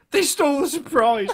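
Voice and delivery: loud, high mock wail; hammed-up aggrieved shout; re-enacted outrage. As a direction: cry it out in acted indignation, close to laughing. Re-enacting own outraged reply.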